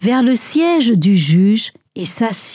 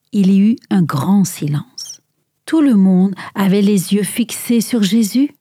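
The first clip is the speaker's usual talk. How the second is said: ordinary delivery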